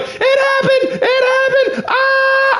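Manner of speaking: shrieking, very high and slow panic; high-pitched childish panic shout; freaking out. Retold or imitated. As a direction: scream it like your world just ended. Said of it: "I was losing my mind".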